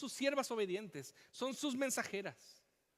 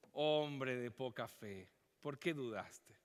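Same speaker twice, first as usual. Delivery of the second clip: gentle rebuke; 10 semitones lower, 5 dB quieter, slower than usual. Retold or imitated. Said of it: "y le dijo".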